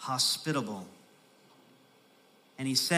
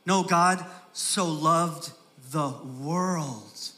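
slow correction; much slower than usual. Shown as a demonstration. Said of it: "oh, I thought it said Christians".

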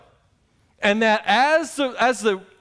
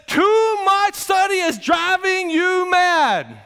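high, slow shouted accusation; high slow retold shout; mocking shout; 8 semitones higher, much slower than usual. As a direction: hurl it at Paul like a judge losing patience. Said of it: "He said this".

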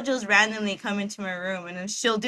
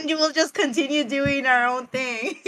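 words squeezed through giggles, a bit higher; can't stop laughing mid-sentence; laughing while talking; 5 semitones higher than usual. Real, not acted. Laughing over awkward moment.

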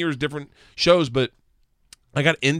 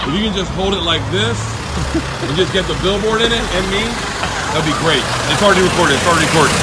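loud instructions; 4 semitones higher, 5 dB louder, faster than usual. Retold or imitated. Instructing driver loudly, slowly.

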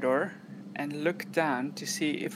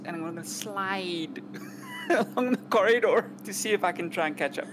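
ordinary talk, nothing marked

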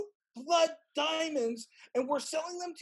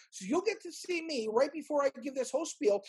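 normal talking voice

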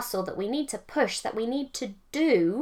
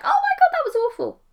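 much higher and louder, a shocked cry; 7 dB louder than usual. Retold or imitated. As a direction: yelp it like a kid when the plaster rips. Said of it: "I was like".

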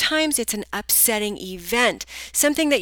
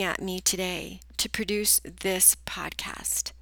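whispering; 3 semitones lower, 8 dB quieter than usual. Real, not acted. Drops to a whisper mid-sentence.

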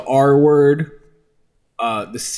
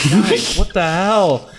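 exasperated; 4 semitones higher, slower than usual. Retold or imitated. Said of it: "I'm like".

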